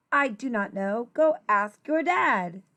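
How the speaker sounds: ordinary talk, nothing marked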